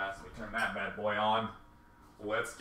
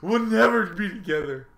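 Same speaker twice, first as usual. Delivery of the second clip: mock dramatic breakup; 9 semitones higher, 9 dB louder than usual. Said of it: "I was like".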